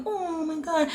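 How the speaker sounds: high, soft mock-gushing; mock swooning. Disappointed reader mimicking an excited reaction, mock swooning. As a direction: pretend to gush, high and softly.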